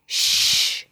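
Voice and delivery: unvoiced hush; shush. Monster hushes noisy Frog, soft hush. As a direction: hush him sharply.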